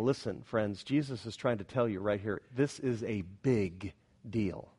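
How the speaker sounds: very low and soft, slowing right down; quiet, weighty appeal to the congregation; grave warning. Real, not acted. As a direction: drop to a hush and space out the last words. Preacher gives a solemn warning.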